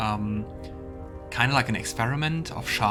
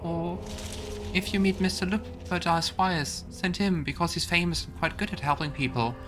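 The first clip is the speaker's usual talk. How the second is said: put-on higher-pitched, hurt little child voice; lost child's letter; 6 semitones higher than usual. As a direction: read it as a child pleading for Mom. Reads a child's letter to Mom.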